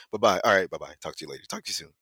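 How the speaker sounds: low, fading string of polite sign-offs. Acted.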